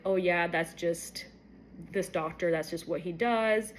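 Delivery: normal talking voice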